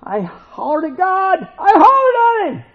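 high, loud mock holler; hollering quote. Pastor retelling a Sunday school remark, loud mock holler. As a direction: yell it like you're hollering at God. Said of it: "some people said".

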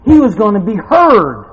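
loud declaration climbing in pitch; forceful preaching. Real, not acted. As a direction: proclaim it, building to a high shouted question.

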